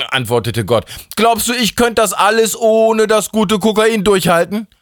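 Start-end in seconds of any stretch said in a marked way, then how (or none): 1.1-4.7 high, sustained indignant outburst; worked-up rant. Acted. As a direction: demand it with the voice pitched right up. God rants at Steffi. (aufgebracht, mit hoher, empörter Stimme)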